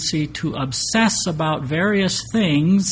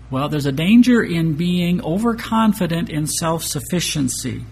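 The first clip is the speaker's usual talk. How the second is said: ordinary delivery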